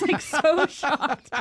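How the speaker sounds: high, slow, stretched disbelief; shocked amazement. Real, not acted. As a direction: draw it out high, stunned. Describes own shock at the praise.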